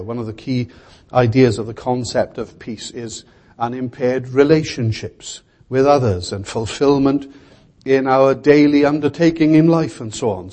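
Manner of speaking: ordinary talk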